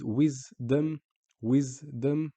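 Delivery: "wisdom" split into wis, dom; stop on the S. Shown as a demonstration. Teacher showing sukoon in English, split syllables. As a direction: break each "wisdom" after the S. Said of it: "We stop on the S".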